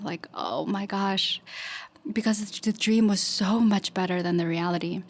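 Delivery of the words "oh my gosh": ordinary delivery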